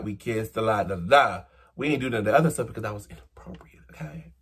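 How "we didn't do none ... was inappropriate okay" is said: fast hushed whisper, confiding; whispered aside. Real, not acted. Confiding to viewers nothing more happened, quick whisper.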